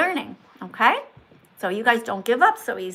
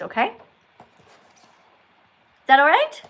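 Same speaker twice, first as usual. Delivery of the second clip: ordinary talk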